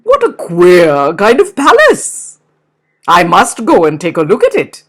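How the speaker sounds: loud, gruff bear voice; a big bear scoffing; scornful bear. Acted. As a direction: bear mocking the palace, loud.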